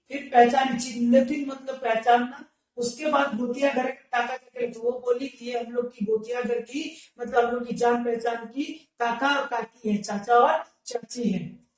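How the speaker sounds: ordinary delivery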